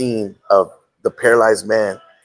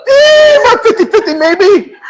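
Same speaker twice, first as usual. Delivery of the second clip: much higher, loud shrugging nurse voice; loud, high mock nurse shrugging off the odds; 8 dB louder than usual. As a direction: toss off the odds like a blasé nurse. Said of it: "the nurse goes".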